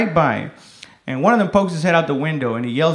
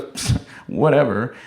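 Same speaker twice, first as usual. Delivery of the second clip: dismissive; 6 semitones lower, 4 dB quieter, slower than usual. Acted. Woman brushes off his refusal.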